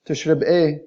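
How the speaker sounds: Egyptian Arabic. Shown as a demonstration. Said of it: "In the Egyptian dialect".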